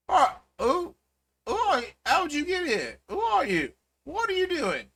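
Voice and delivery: high, squeaky startled goblin; goblin guard. Acted. Goblin guard startled by a kid flying into the wall, high squeak. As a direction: yelp it out like a panicked little goblin cop. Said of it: "and he goes".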